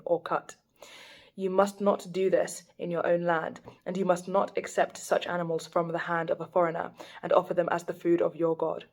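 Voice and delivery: normal talking voice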